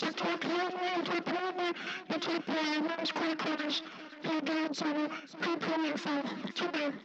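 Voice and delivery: high voice changer squeak; very high processed voice effect; through a megaphone. Shown as a demonstration. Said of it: "turn on megaphone".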